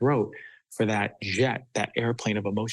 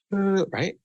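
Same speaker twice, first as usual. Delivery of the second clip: put-on gasping child sob; 9 semitones higher, 5 dB louder than usual. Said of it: "They're going".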